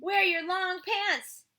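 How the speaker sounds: high, loud, slow teacher voice; bossy teacher. Acted. Reading the teacher's line to the child, high and loud. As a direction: order it like a strict teacher.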